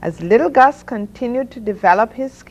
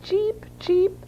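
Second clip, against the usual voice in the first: very high, tiny duckling chirps; duckling cheeps; 13 semitones higher, 5 dB quieter than usual. A narrator's reading. Ducklings chime in after Mother Duck, high cheeping. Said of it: "said the ducklings".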